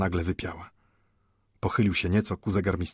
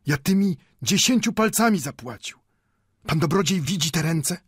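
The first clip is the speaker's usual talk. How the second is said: put-on high, insistent old man's voice; impetuous; 10 semitones higher, 4 dB louder than usual. A narrator's reading. Old Mendel shows the watchmaker his working hands, impetuous. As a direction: press the point hotly, pitched up. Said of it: "zawołał z pewną porywczością w głosie".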